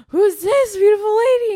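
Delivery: loud, high-pitched dazzled awe; hammed-up starstruck cry; super high patient. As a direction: imitate a groggy patient gushing in amazement. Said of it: "still super high".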